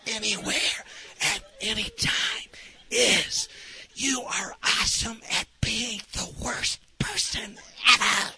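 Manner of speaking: slow, hushed, deliberate praise; whispering. Retold or imitated. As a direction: whisper a careful compliment word by word. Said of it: "I started whispering".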